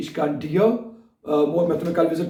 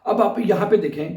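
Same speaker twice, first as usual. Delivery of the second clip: ordinary delivery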